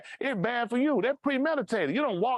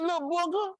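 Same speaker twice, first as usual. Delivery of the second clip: laughing; 11 semitones higher than usual. Real, not acted. Laughing while teasing.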